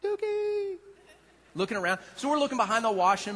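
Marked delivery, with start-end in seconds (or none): 0.0-0.8 much higher, small voice; little brother calling the cat; brother's high call. Retold or imitated. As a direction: pipe the name up high. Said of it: "He's like".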